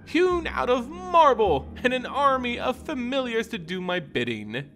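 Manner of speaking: high, purring vampire lady, proud and playful; Henrika the vampire. Acted. Vampire boasting to Odric about her mansion, high and smug.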